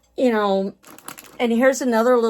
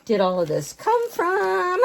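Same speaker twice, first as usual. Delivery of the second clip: mock astonishment; 8 semitones higher than usual. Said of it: "you're sitting there going".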